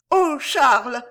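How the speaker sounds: high, loud cry of dismay; horrified. A narrator's reading. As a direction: exclaim in dread to a husband. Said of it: "s'épouvanta la duchesse".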